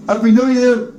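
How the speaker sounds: high-pitched festive nephew; cheerful nephew. Acted. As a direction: call the greeting in a high character voice. Nephew's parting wish.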